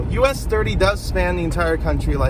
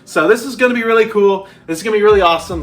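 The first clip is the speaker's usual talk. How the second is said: excited; 5 semitones higher, 6 dB louder, faster than usual. Real, not acted. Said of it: "I'm really excited for it".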